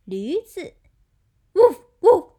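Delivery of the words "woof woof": high, very loud short barks; dog barking. Shown as a demonstration. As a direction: bark like an excited little dog. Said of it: "It's a dog".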